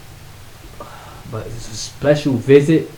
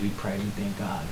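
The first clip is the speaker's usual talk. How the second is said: ordinary talk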